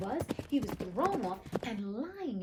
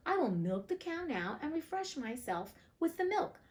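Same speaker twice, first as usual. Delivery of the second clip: a lightly voiced Hans, raised higher; higher, hopeful Hans; 6 semitones higher than usual. A narrator's reading. Hans plans to milk the cow, raised higher voice.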